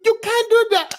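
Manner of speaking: very high, loud tantrum; spoiled prince shouting; very high whiny British brat. Retold or imitated. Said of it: "The accent".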